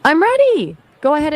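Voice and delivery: "I'm ready" jumps high and bright with eagerness; eager ready. Real, not acted. AI eagerly telling Hania to go ahead, excited. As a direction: pitch way up, eager to hear.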